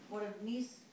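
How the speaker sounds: ordinary delivery, nothing marked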